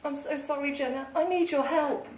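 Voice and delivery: very soft, defeated plea; tearful defeat. A narrator's reading. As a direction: admit defeat in a small, soft voice. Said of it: "sniff back a tear and admit defeat".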